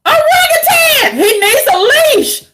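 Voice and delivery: high, loud mocking outburst; laughing shout. Real, not acted. Woman ranting about a man she finds ridiculous, laughing shout.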